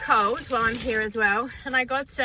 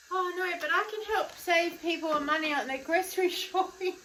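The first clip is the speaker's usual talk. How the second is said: mock chirpy pitch; 10 semitones higher, 5 dB quieter than usual. Imagined cheerful pitch to reporter.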